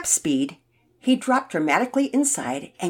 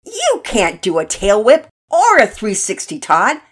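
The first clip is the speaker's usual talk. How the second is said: loud, raised-pitch challenge; yelling; 4 semitones higher, 11 dB louder than usual. A narrator's reading. Finn disputing Todd's boast, yelling. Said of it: "Finn yelled".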